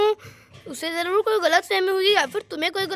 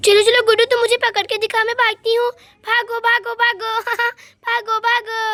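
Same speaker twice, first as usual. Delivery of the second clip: put-on playful child; 4 semitones higher, 3 dB louder than usual. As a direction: play a giggling little kid in a chase. Child plays chase, voiced higher. (ऊँची आवाज़ में खेलता हुआ बच्चा)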